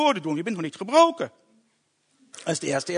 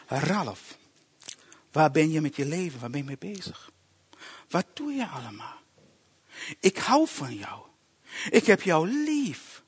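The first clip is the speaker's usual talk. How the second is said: soft, low, slowed voice speaking to the spirit; voice of God; 4 semitones lower, 5 dB quieter than usual. Retold or imitated. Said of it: "En het sprak tot mijn geest".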